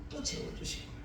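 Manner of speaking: 같은 말을 속삭이듯 다시; breathy whisper, no voice at all; whispered repeat. Shown as a demonstration. Whispered repeat of same line. (목소리 없이 속삭여서)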